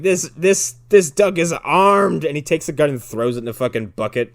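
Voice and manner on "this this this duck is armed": loud, raised, hammed-up cop alarm; slow down and overplay the cop's warning; mock dramatic threat. Retold cop's zinger threats.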